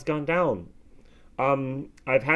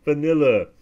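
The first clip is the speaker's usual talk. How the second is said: ordinary talk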